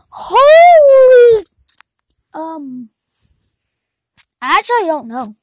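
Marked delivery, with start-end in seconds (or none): 0.1-1.5 very high loud exclamation; surprised shout. Acted. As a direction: blurt it out in shock. Sudden exclamation.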